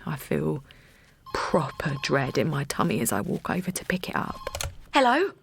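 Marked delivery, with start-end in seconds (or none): 4.9-5.3 high, loud and tense; fearful greeting at three a.m; anxious hello. Acted. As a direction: pick up the phone dreading the news. Answering a dreaded phone call.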